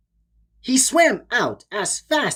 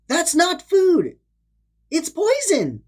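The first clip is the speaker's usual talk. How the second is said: higher, raised alarmed cry; narrated shout; 10 semitones higher than usual. A narrator's reading. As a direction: warn the fish in alarm. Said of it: "he shouted at the fish to stop".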